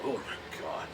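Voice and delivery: low, soft exasperated sigh; fed-up under the breath; exasperated mutter. Retold or imitated. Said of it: "they're just like".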